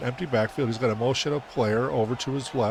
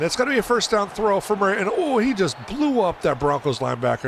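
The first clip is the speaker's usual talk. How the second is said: higher and louder, thrilled; excited; 7 semitones higher, 4 dB louder than usual. Real, not acted. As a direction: call the big hit with a thrill. Big hit in play-by-play.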